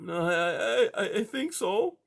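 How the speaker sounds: high, hesitant scared mouse; timid high little voice; frightened mouse. A narrator's reading. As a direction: stammer it out in fear.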